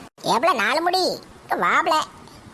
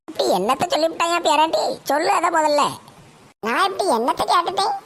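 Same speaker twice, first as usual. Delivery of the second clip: normal talking voice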